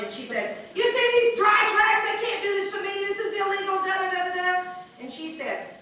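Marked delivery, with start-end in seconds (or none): 0.7-4.7 high, loud mock yelling. Angry cellmate protesting to the cell, mock yell. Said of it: "she's screaming and yelling".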